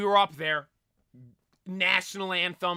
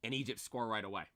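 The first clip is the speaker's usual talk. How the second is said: deflated quiet repeat; 7 semitones lower, 9 dB quieter, faster than usual. Real, not acted. Fan repeating the bad news, deflated and soft. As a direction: lower and softer, dejected. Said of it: "repeated flatter".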